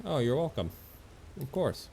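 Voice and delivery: usual speaking voice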